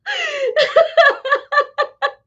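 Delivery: a long high burst of laughter. Real, not acted. Laughing after telling the neighbor no.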